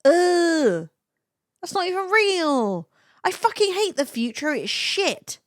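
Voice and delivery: high, loud groan into complaint; exasperated. Real, not acted. Streamer fed up with fake AI search results, exasperated. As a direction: rant in high-pitched exasperation.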